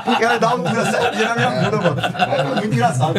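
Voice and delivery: high, quick words through real laughter; laughing while talking. Real, not acted. Dad recalls his daughter's funny complaint, laughing. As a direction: crack up while telling it. Cracking up over own anecdote.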